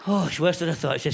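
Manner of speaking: ordinary delivery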